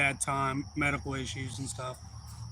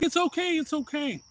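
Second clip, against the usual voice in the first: high-pitched retold reassurance; acted high, placating voice; soothing a child; 13 semitones higher than usual. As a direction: coo it high to calm a scared kid. Retelling soothing a frightened child.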